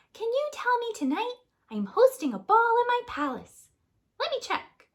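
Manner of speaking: very high, loud princess call; Cinderella calling. Acted. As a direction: shout down from the castle. Cinderella voiced.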